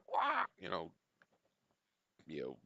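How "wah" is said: a short high mocking wail, much higher; mock the video's weird scream. Reacting to a horror video, imitating its weird scream. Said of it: "the weird kind of screaming".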